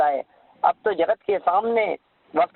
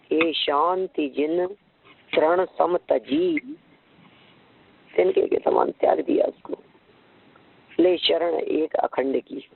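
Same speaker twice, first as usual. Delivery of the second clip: slow, drawn-out devotional chant; slow reverent poem recital; verse recitation; slower than usual. Acted. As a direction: intone each phrase slowly, like a hymn.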